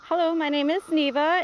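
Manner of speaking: ordinary delivery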